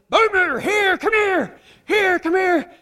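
high, insistent scolding holler; scolding dad. Retold or imitated. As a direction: get onto the dog like a fed-up dad. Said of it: "a dad get onto a child".

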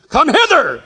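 loud, high mock command; playful summons. Preacher imagines Jesus summoning fish from the sea, playful summons. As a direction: call it out like a commanding shout, in jest.

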